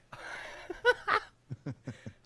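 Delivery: loud, put-on mocking laugh; sarcastic laugh. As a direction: laugh sarcastically to needle the other debater. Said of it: "What if I sarcastically laugh".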